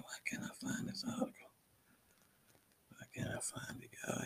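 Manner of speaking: whispered frustration to himself; unvoiced whisper while hunting for something. Real, not acted. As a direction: mutter it under your breath, searching. Searching aloud under breath.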